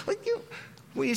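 laughing. Real, not acted. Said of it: "laughing at the joke".

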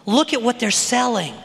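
normal talking voice

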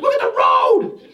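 sharp mock yell at the driver; wife yelling. Wife shouting at husband to watch the road. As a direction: bark it like a startled passenger. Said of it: "Heidi's like".

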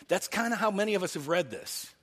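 ordinary delivery

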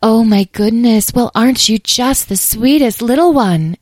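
high, loud, cooing fairy; exclaiming. A narrator's reading. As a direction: fuss over a little darling. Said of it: "exclaimed Dahlia".

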